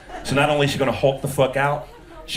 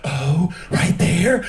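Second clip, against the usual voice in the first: slow moan; 5 semitones higher, much slower than usual. Retold or imitated. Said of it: "She's gonna be like".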